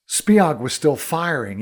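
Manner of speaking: plain reading voice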